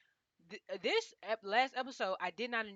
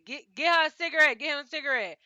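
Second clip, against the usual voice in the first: louder, higher mock yell for a cigarette; mock yell, much louder; John's loud call; 6 semitones higher, 12 dB louder than usual. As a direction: shout it out laughing at the chaos. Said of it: "when John said".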